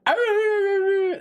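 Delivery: imitating a friend's wolf howl; mock wolf howl. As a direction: howl it out like a playful wolf pup. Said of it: "Wolf's how he's like".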